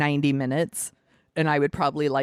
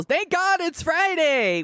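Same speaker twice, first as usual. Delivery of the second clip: higher, slow, drawn-out triumphant yell; mock yell; 11 semitones higher, slower than usual. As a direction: belt it out like a movie catchphrase. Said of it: "Pep gets to yell".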